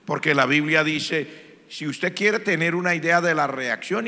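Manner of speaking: ordinary delivery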